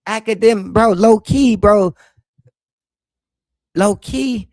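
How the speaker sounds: loud emphatic aside. Real, not acted. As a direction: punch it out to the room. Emphatic outburst.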